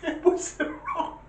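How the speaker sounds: high, slow, wheezy laughing words; helpless laughter at your own joke. Real, not acted. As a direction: squeeze it out through laughter.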